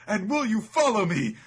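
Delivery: high, loud swooning echo; hammed-up smitten heroine; lovestruck misreading. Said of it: "she recalls".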